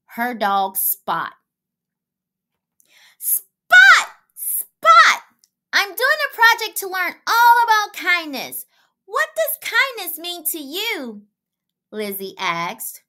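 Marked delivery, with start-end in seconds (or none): 3.2-13.0 very high, loud excited call; calling the dog. A narrator's reading. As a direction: shout for the dog across the yard.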